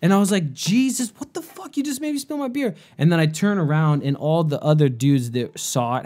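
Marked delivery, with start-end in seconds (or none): 0.5-2.7 high, disbelieving protest; imitating an indignant shriek; shocked outrage. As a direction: react like your beer just got smacked away. Beer knocked from hand.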